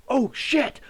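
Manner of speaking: high, loud startled curse; retold panicked curse. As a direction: blurt it in sudden panic. Realizing the grenade is live.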